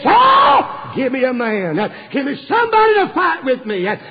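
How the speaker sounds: booming giant's challenge, pitched high; the giant Goliath shouting his challenge at Saul's army; Goliath's taunt. Acted. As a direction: bellow the dare across the valley.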